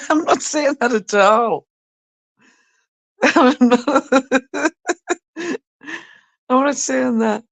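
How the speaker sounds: high, loud, laughing through the words; laughing denial. Real, not acted. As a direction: protest while cracking up.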